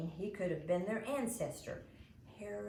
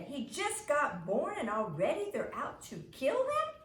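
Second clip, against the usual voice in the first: outraged; 7 semitones higher, 7 dB louder than usual. Acted. Imogene outraged at Herod's plan.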